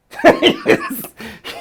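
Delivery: a sudden high burst of laughter; loud high-pitched laughing burst. Real, not acted. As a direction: let out a big loud laugh.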